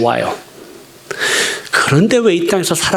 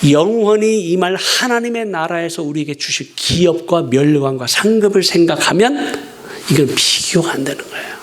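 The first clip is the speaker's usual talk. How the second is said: usual speaking voice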